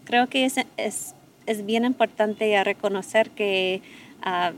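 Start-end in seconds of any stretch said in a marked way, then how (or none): none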